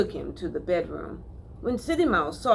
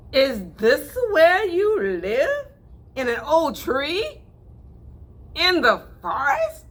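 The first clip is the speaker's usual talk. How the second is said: surprised; 10 semitones higher, 7 dB louder, slower than usual. Acted. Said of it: "With a surprised look".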